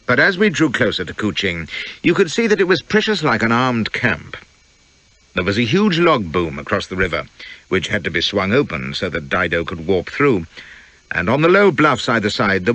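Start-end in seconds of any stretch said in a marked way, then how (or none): none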